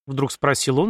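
usual speaking voice

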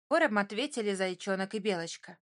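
plain reading voice

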